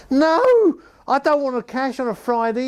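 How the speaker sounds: the manager waving the cash off; pinched high voice refusing; John Weller's accent. Retold or imitated. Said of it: "I can't do the accent properly now".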